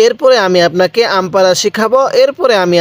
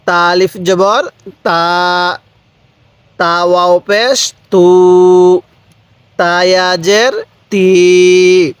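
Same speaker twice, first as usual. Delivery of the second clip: slow, syllable by syllable, each letter named clearly; slow spelling drill; slower than usual. Shown as a demonstration. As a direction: spell it out slowly for a beginner. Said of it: "কিভাবে শিখব?". (ধীরে ধীরে বানান করে)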